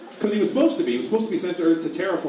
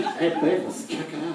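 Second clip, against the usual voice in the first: Butthead impression; slower than usual. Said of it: "Are you doing a Butthead impression?".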